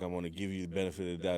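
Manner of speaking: usual speaking voice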